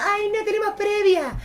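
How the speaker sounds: squeaky high mock girl. Mimicking a girl in the hall, high and squeaky.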